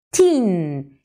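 put-on "Teen" with a long drawn-out ee; teacher stretching "Teen" for the class. Shown as a demonstration.

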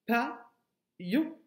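"pa-yon" drawn out slowly, each syllable apart; teacher spelling out syllables slowly for young pupils; slow syllable split. Shown as a demonstration. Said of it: "je te demande d'enlever la syllabe".

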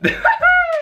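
sudden high-pitched laugh bursting out; high squeal of laughter. Real, not acted. Laughs at a comment.

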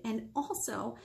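usual speaking voice

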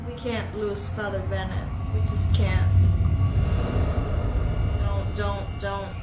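ordinary talk

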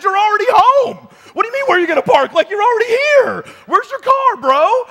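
yelling at the neighbor that he's already home; yelling back. Retold or imitated. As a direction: shout it in disbelief. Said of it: "we started just kinda yelling back and forth".

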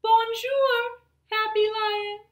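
high, bright sing-song bonjour; cheerful greeting. Acted. Zookeeper's son greets the lion in a high cheery voice.

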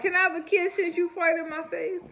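high, loud playful request. Real, not acted. Playful ask for kiss.

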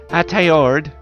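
high, drawn-out shout of a name; loud, high calling-out. Acted. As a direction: call the boy's name at full volume. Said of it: "loud as I can".

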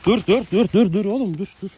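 high, loud repeated stop at a horse; urgent shout. Real, not acted. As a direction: halt the horses before they clash. Horses getting rowdy together. (acil, yüksek sesle bağırarak durdurma)

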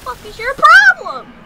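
loud, high furious yell; angry yell. Real, not acted. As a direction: yell at the enemy. Enemy attacks, he yells.

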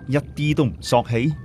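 plain reading voice

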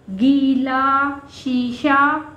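गीला and शीशा with a long, clear ee sound; very slow गीला, शीशा; drawn-out long ee. Shown as a demonstration. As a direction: stretch the ee in गीला and शीशा slowly. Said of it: "जेर की आवाज़ के साथ बोलेंगे".

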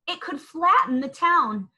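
ordinary delivery, nothing marked

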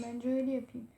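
ordinary delivery

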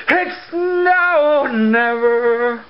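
high, slow dramatic line; mock-epic proclamation; dramatic drawn-out. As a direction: stretch it out like a movie trailer. Dramatic repeat of phrase.

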